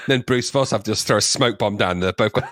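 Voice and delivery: ordinary delivery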